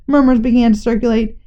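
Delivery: plain reading voice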